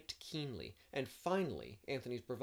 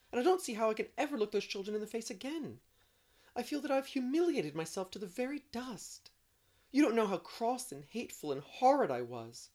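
put-on higher, louder, tearful girl pleading; distressed Anne; 10 semitones higher, 6 dB louder than usual. A narrator's reading. Anne protesting to Marilla, tearful and upset. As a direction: spill the remorse in a rush.

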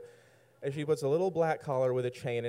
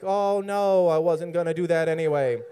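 loud, higher, hammed-up protest; loud mock dismay; 4 semitones higher, 9 dB louder than usual. Teller replays his reply to her order, loud mock dismay.

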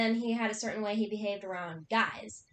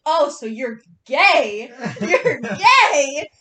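loud, drawn-out mock questioning; retold crowing question, breaking into laughter; gleeful realization; 2 semitones higher, 12 dB louder, much slower than usual. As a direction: stretch it out loudly, pouncing on the discovery. Acts out her gleeful realization.

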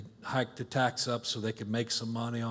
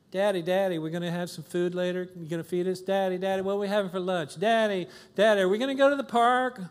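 imitating kids nagging their dad, rising higher; whiny kids; 4 semitones higher than usual. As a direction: pester Daddy like an impatient child.

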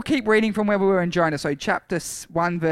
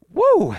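loud, high "woo"; high-pitched whoop of relief; 8 dB louder than usual. Real, not acted. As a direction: let out a loud whoop after a long effort. Relief after long reading.

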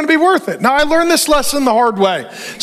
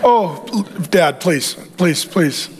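lower, softer begging son; pleading Adam; 6 semitones lower, 3 dB quieter, slower than usual. Acted. Adam begging God the animal isn't his wife, pleading. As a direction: plead with Father like a worried son.

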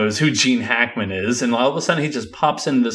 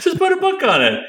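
squeaky high voice. Acted. Echo in very high voice.

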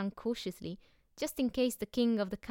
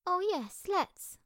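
put-on eager little girl; 10 semitones higher, 3 dB louder, slower than usual. A narrator's reading. Annika eagerly agrees.

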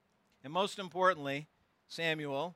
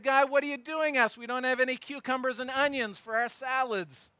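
whiny complaint; 5 semitones higher than usual. Retold or imitated. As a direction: grumble like an ungrateful crowd. Israelites complaining again.

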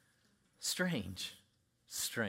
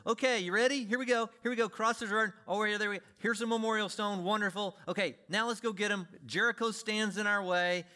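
lightly voiced God, upbeat and a bit loud; an eager commander leading the people on; voicing the Lord; 6 semitones higher, 4 dB louder than usual. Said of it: "and the Lord says".

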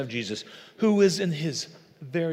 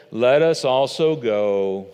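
lower, slow, mopey donkey drone; Eeyore voice; 4 semitones lower, 5 dB louder, slower than usual. Acted. Thomas voiced as Eeyore for the congregation: lower, slow, gloomy drone. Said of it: "give you Thomas in the voice of Eeyore".

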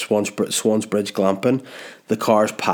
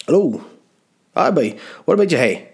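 high, swooping mock Ballymena country accent; 6 semitones higher than usual. Camp site man answers the phone, mock Ballymena accent. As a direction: greet in an exaggerated Ballymena lilt, pitched up. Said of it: "Why you speak like you're from Ballymena".

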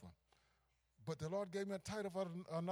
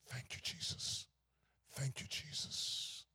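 soft, breathy whisper of thanks; slow hushed prayer of gratitude; 4 semitones lower, 5 dB quieter, slower than usual. Retold or imitated. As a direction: whisper it like a dying man in pain. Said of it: "he just said".

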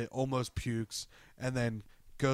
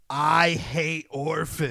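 slow, deliberate defiant repeat; mock stubborn villain; slow defiance; 4 semitones higher, 4 dB louder, much slower than usual. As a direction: draw it out, daring him. Retold defiant repeat in standoff.

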